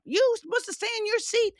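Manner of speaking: high-pitched mock yell at a child; imitating a parent yelling at a wandering toddler. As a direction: squeal it like scolding parents. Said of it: "they start yelling at him".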